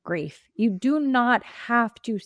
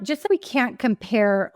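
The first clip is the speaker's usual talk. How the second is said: ordinary talk, nothing marked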